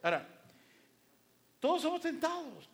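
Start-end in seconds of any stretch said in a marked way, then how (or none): 1.6-2.7 high, loud emphatic opener; rising call to attention. Real, not acted. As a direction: proclaim it to the congregation. Preacher repeats key phrase.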